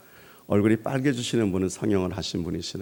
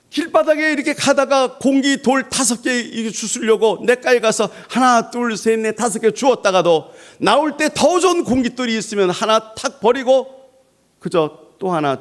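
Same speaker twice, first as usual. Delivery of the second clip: 1 energetic preaching; 8 dB louder than usual. Acted.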